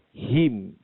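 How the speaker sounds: him with a clear I vowel; him, not hem; correct him. Shown as a demonstration. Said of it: "bacaan yang betulnya adalah H I M".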